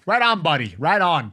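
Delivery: chummy Canadian "Right on, buddy". Shown as a demonstration. Showing how Canadians say "Right on, buddy", light Canadian accent. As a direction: toss it off like a friendly Canadian. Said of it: "You gotta say "right on".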